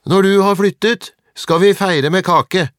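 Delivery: put-on loud, high boy's jeer; shouted taunt. A narrator's reading. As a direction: holler it slowly across at him. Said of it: "ropte Atle".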